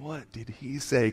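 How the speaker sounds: soft, slow puzzled question; wondering to oneself. Retold or imitated. Onlookers thinking about the preacher's words, quiet wondering. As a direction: voice a private thought, softened and drawn out. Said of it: "People are probably thinking".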